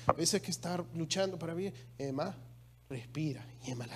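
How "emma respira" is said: put-on soft and low, coaxing a struggling child to breathe; gentle coaxing. Wife soothing little Emma as she struggles, soft and low. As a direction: ease the little one, quietly and lower. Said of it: "mi esposa le dice a Emma".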